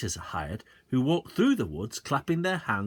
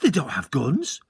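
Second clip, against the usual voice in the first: alarmed; 4 semitones higher, 6 dB louder than usual. A narrator's reading. Boy protests.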